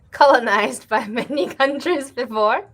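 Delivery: laughing through the words; amused chuckles breaking the phrase. Real, not acted. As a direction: giggle out colonized by many countries. Laughs through the colonized line.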